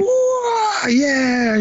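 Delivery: very high, mock war whoop. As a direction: ham up a reckless weekend warrior cheering. Weekend warriors whooping while shooting.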